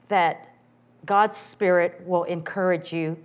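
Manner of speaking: ordinary delivery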